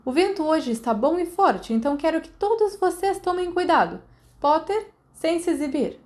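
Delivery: stern instructor warning; flying instructor. A narrator's reading. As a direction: warn the class with brisk authority.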